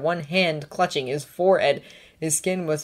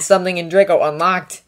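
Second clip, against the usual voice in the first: plain reading voice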